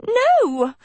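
high frightened squeak of "No!"; shrill little cry of dismay. A narrator's reading. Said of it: "Sophie squeaked".

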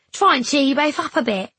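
high, loud, cheery lad; cheery boy. Acted. Boy trying to cheer up two friends, cheery. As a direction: lift the voice to cheer them up.

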